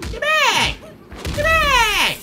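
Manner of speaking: very high, loud command; warding-off shout. Real, not acted. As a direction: yell it high to drive someone off. Warding off an opponent.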